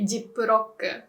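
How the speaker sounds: "Ziploc" as jippurokku, in even syllables; Japanese pronunciation. Shown as a demonstration. Teacher settling on the short name, Japanese pronunciation. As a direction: say "Ziploc" the Japanese way, ji-ppu-ro-kku. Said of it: "so we'd say".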